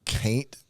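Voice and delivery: "Caint" with a long ay, as in Southern talk; Southern "can't". Shown as a demonstration. Writer showing how he spelled it, Southern "Caint". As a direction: say "Caint" to rhyme with paint. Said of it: "C A I N T".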